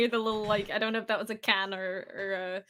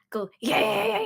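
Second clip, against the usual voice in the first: vocal exercise demo; 12 semitones higher, 4 dB louder than usual. Retold or imitated. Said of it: "like, like that".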